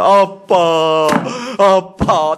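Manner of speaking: put-on long drawn-out child's bawl; mock wailing. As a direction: imitate a child wailing for daddy. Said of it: "막 거기서 대성통곡합니다". (대성통곡하는 아이 흉내)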